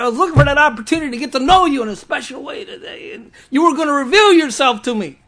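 high, loud exasperated prayer to God; frustrated pleading. Retold or imitated. Preacher voicing pastor's frustrated late-night prayer, high and exasperated. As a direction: pour out frustration, voice climbing.

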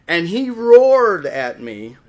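loud, very slow, drawn-out climax; dramatic storytelling. Real, not acted. Preacher telling how the lion roared, loud and drawn out.

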